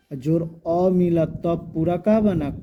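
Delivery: normal talking voice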